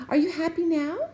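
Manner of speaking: high, loud hopeful call. A narrator's reading. Jubal calling to the captain, high and loud. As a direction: shout the question through the door. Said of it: "he called".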